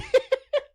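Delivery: a short, very high laugh; a quick real cackle; amused squeal of a laugh. Real, not acted. Laughs at own joke.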